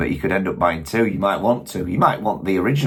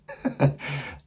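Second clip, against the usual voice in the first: short amused laugh; 8 semitones higher, 6 dB quieter than usual. Real, not acted. Laughs at own accent remark.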